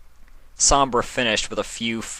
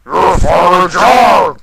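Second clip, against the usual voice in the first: much higher, loud, slow yell; shouting; 10 semitones higher, 14 dB louder, slower than usual. A narrator's reading. Sombra yells into Nightmare Moon's squished face. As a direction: bellow it right in her face.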